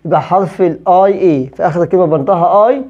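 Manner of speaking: ordinary talk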